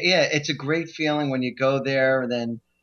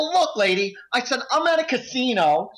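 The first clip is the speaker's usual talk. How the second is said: higher-pitched, indignant telling-off; mildly heated retort to a dealer; retold annoyance; 6 semitones higher than usual. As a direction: lay down the law, acted out. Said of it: "annoyed at it".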